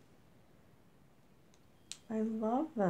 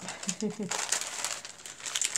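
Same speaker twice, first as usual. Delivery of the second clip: short chuckle. Real, not acted. Crafter teasing herself for being big on scallops, light chuckle. Amused at own love of scallops.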